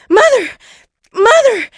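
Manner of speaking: put-on very high, loud boy's yell; excited out-of-breath hollering; breathless shout. A narrator's reading. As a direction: shout for mother, winded from running. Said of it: "Jack shouted, out of breath".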